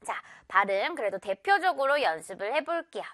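ordinary delivery

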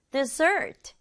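usual speaking voice

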